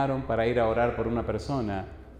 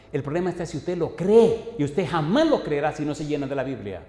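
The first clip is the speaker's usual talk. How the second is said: higher-pitched, forceful preaching; predicación vehemente; 4 semitones higher, 3 dB louder than usual. Acted. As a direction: hammer the point into the congregation. (predicación con fuerza y énfasis)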